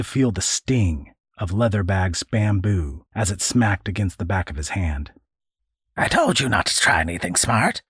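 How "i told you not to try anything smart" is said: put-on fast, biting old man's snarl. Old man punishes boy, snarling. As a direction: snap it at the boy. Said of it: "the old man snarled".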